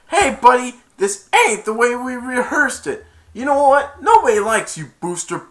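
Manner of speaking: loud, high scolding quote; acted telling-off. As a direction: tell off a disliked sidekick. Acting quarrel with Booster.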